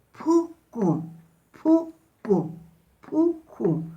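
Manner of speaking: pucu with a puff of breath on the p; aspirated p in pucu. Shown as a demonstration. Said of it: "botamos el aire hacia afuera".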